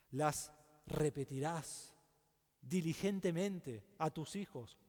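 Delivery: slowly, each word weighted; preacher stressing the command to repeat, slow and deliberate; slow emphasis. Real, not acted. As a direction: let the command sink in slowly. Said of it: "slow emphatic scripture line".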